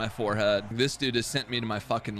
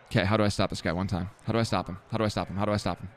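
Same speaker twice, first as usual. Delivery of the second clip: ordinary delivery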